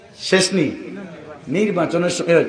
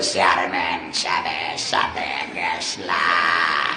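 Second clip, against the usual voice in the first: much lower, softer, slow croak; voice gone hoarse; 5 dB quieter, slower than usual. Retold or imitated. Young man hoarse from rally shouting answers his mother. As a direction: rasp it out like a ruined throat. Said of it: "হের গলার ব্যাটারির শ্বাস গেছে".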